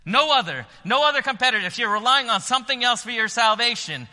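preaching at full force. Acted. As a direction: lift the whole room with the invitation.

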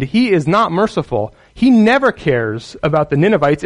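usual speaking voice

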